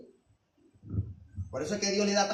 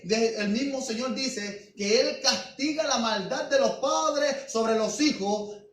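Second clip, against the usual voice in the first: higher, louder preaching, slowing for weight; fiery preaching; 7 semitones higher, 7 dB louder than usual. Real, not acted. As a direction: hammer it home to the church. Preaching at full force.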